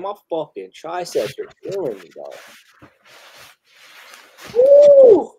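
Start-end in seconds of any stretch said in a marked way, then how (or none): 4.6-5.3 a big, high, loud "woo"; loud high-pitched whoop of excitement; excited whoop. Real, not acted. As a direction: let out a hype holler. Hyped hollering as the next song starts.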